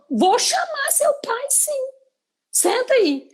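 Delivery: high, slow teasing warning; teasing threat. Retold or imitated. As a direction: tease the boy with a sing-song threat. Professora imitando ameaça brincalhona. (ameaça brincalhona, voz aguda)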